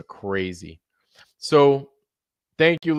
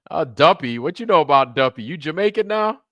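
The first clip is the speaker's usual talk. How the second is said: laughing, higher mock disbelief; teasing; 4 semitones higher, 5 dB louder than usual. Real, not acted. Streamer to chat about Jamaican slang, teasing. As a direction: rib the viewer for the slang.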